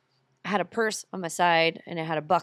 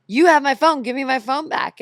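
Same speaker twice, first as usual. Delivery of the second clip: loud, high acted confrontation; retold demand; 7 semitones higher, 7 dB louder than usual. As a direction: act out demanding it from a thief. Retelling confronting the phone thief.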